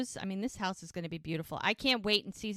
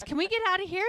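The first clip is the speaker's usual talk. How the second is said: high, louder pleading question; urgent plea; 10 semitones higher, 5 dB louder, much faster than usual. Retold or imitated. Boyfriend begs to leave, high urgent plea. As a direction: pitch it up, desperate to get away. Retold urge to escape.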